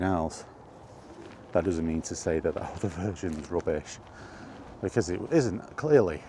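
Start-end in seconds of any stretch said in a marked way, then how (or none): none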